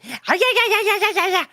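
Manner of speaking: put-on dog yapping at her boyfriend over the fence, high excited yeahs. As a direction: voice the dog barking back eagerly. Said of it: "Penelope's like".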